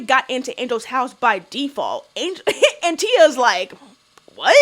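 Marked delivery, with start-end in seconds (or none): 4.3-4.6 imitated offended, rising 'what?'; caught off guard. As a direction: mimic being taken aback, slightly offended. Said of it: "caught off guard, seems a little offended".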